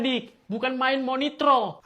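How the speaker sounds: ordinary delivery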